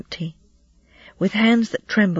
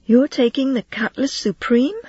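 astonished; 6 semitones higher than usual. A narrator's reading. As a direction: let the voice jump up in disbelief. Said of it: "Goat's voice rose with astonishment".

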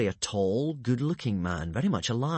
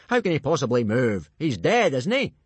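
impatient snap; 3 semitones higher, 5 dB louder than usual. A narrator's reading. Gobber arguing with Hiccup about the corpse, impatient snap. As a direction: scold the boy loudly. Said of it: "snapped Gobber the Belch".

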